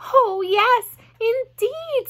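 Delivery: high, loud, delighted agreement; thrilled piglet exclamation; excited. A narrator's reading. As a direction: burst out eagerly. Wilbur thrilled to meet his friend.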